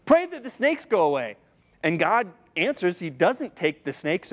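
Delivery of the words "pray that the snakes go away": much higher, frantic mock apology; mock panicked people. Israelites begging Moses to end the snakes, high mock panic. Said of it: "the people are like".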